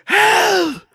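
high strained cry of help; tether imitation. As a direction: squeeze out a raspy high yelp. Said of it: "You sound like this".

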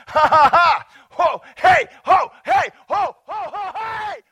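loud, high mock-drunk ha-has and ho-heys; hammed-up boisterous hooting laughter; drunken whooping laughter. Acts out someone drinking a while.

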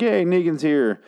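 high-pitched little hooray; mock cheer. Viewer spotting Negan in the gun scope, mock cheer.

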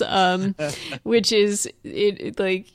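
usual speaking voice

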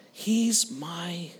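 slow, with a long pause before a soft FRIEND; solemn declaration. Retold or imitated. Preacher closes on his answer to the gang, slow solemn hush. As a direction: let the last word drop quietly.

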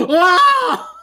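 high startled shout; startled laughing yelp. Real, not acted.

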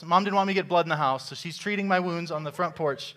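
usual speaking voice

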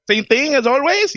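put-on high-pitched bubbly Puerto Rican accent. Sweet lady takes a regular's order, in an imitated Puerto Rican accent. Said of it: "has that weird Puerto Rican accent".

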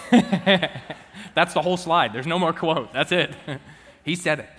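amused, laughing delivery. Real, not acted. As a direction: laugh as you admit the joke. Laughing at his own joke slide.

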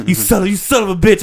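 mock yelling. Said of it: "yelling in the mirror".

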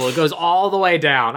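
ordinary delivery

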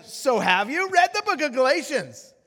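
high, loud, needling question; a little snarky. Retold or imitated. Preacher pressing his seatmate about Galatians, snarky. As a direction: needle him with the repeated question. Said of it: "maybe I got a little snarky".

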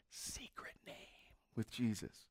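hushed, voiceless whisper. Real, not acted. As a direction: whisper it like a secret. Confiding a secret name.

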